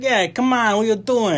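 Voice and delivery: high, loud cliche Bronx tough guy; Bronx accent. Acted. Mocking an actor's cliche accent in the movie, Bronx accent. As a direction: talk from the side of your mouth, Bronx style. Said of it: "a cliche Bronx accent where he kinda".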